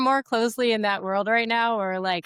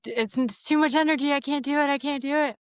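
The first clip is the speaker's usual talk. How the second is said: overwhelmed whine; 6 semitones higher, faster than usual. Retold or imitated. Overwhelmed mother quote.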